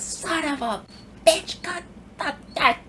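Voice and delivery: very high mock cursing, broken into bursts; old lady cursing. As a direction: sputter curses in a squeaky-high old lady voice. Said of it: "just starts cursing profusely".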